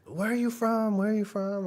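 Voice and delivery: high eager mock question; imitating the cook. Vlogger imitating the cook asking where they're from, high eager voice. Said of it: "he's like".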